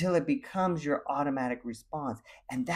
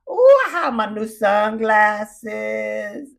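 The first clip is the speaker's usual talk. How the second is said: higher, louder, slow gushing delight; excited; 8 semitones higher, 10 dB louder, much slower than usual. Retold or imitated. Showing off new sunglasses at the office, gushing. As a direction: savor every word like showing off. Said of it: "I was so excited".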